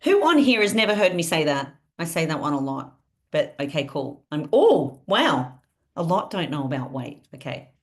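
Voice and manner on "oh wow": ordinary delivery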